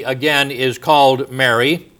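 usual speaking voice

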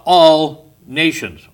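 slow down and press hard on "all nations"; stress on nations. Shown as a demonstration. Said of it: "emphasize the word nations".

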